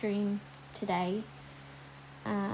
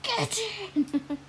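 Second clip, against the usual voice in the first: retold cheer; 9 semitones higher than usual. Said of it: "I was, like, screaming".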